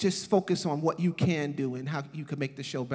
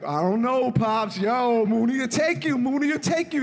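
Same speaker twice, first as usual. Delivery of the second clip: higher, louder teasing taunt; egging him on; 6 semitones higher, 5 dB louder than usual. Retold or imitated. [name] teasing John that Mooney will win, louder mock taunt. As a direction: needle him playfully. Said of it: "I'm like".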